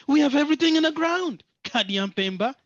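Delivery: mock-defiant rapid shout of independence; defiant outburst. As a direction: throw it in their face with pride. Imagined Muntu defying Kadian Pemba.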